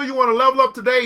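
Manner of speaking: usual speaking voice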